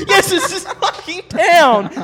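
high, loud, drawn-out yell breaking into laughter; exasperated yell. Retold or imitated. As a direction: yell the obvious slowly at a clueless driver. Exasperated reply to the driver.

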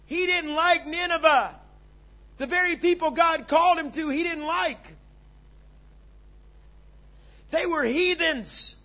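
loud, high, slowed declaration; emphatic preaching. Real, not acted. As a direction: hammer it home.

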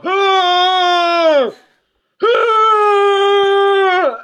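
high loud pained wails; winded cry. Retold or imitated. Daughter lying on the rocks after crashing, high pained cries. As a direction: imitate a winded girl crying out. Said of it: "laid on the ground going".

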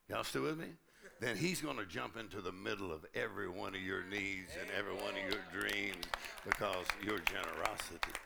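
usual speaking voice

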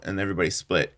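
ordinary talk, nothing marked

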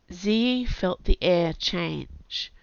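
ordinary delivery

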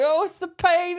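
very high mock wail; high whiny moan. Charlotte complaining of pain to the nurse, high mock wail. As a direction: whimper it like a dramatic patient. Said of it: "Charlotte would be like".

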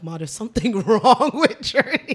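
amused outburst to mom, laughed through; laughing retelling. Retold or imitated. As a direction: quote yourself while cracking up.

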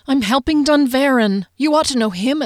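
high, insistent pleading; urgent appeal. A narrator's reading. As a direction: appeal desperately to her captors. Captive appeals to the guards.

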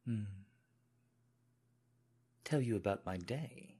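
earnest British servant promise; British accent. Pretend. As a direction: deferential British servant, slightly halting. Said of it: "put on a British accent".

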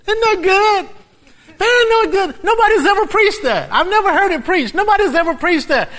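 high, loud preacher's cry; fervent preaching. Real, not acted. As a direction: preach it with full fire. Preaching at full force.

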